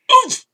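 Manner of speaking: oomph burst out high and loud; punched oomph. Real, not acted. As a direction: throw oomph out as a squeal. Mocking tiny battery power.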